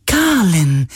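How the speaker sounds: hohe, helle, freudige Geisterstimme; freudiger Geist. Acted. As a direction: jubelnd den Namen erkennen. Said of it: "sagte der Geist plötzlich freudig". (hohe, freudige Geisterstimme)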